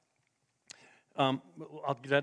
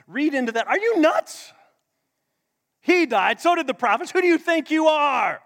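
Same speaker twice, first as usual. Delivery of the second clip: louder, outraged accusing voice; indignant crowd; 4 semitones higher, 7 dB louder than usual. Acted. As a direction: hurl the accusation back at him. Crowd retorts angrily to Jesus.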